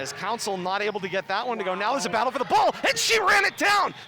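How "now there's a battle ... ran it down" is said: fast, loud and high call of the scramble; excited play-by-play. Real, not acted. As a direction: ramp up as the ball is chased. Scramble for a loose ball.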